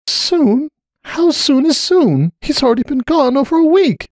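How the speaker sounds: high, slow, strained pleading; desperate. A narrator's reading. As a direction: ask it as if you're shrinking inside. Cupcake shrinking, near tears.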